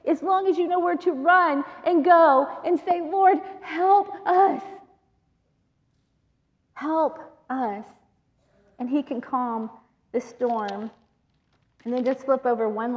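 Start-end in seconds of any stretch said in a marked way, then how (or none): 3.0-7.8 slow, pleading cry for help; desperate plea. Acted. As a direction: call out to the Lord from the storm. Crying out to Jesus.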